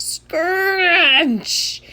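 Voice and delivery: drawn-out, high "scrunch" noise; comic squashing sound; hug sound effect. A narrator's reading. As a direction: squeeze the word out as a crushing hug.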